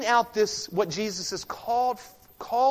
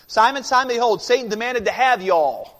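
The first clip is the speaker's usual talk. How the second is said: loud, higher Southern-style "y'all" rendering; vernacular translation; 4 semitones higher, 8 dB louder than usual. Acted. Preacher retranslates the verse for the congregation, loud vernacular. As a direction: punch it out in everyday vernacular. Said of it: "translate this into a vernacular we would understand".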